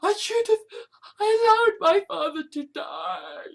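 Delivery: crying. Acted. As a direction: voice breaking into sobs over the loss. Emotional confession about dead father.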